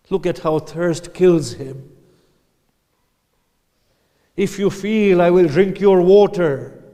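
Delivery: high, loud impassioned plea to the army. Acted. Hussein shows the thirsty child to the army, loud high plea. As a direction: beg the enemy for water, voice rising.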